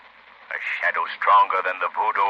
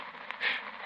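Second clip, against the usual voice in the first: sharp soft shush; 14 dB quieter than usual. Acted. Lamont hushing Margot as someone approaches. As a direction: hush her at once.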